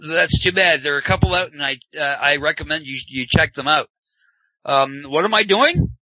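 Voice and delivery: usual speaking voice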